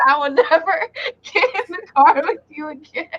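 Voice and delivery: fast, laughing through it; laughing vow. Retold or imitated. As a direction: swear it off while laughing.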